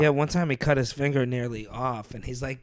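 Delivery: ordinary talk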